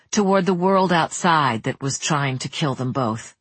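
ordinary delivery, nothing marked

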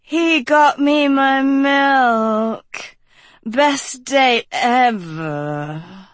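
put-on very slow, weepy drunken slur; slurred. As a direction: slur it, woozy and sad. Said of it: "Dot slurred".

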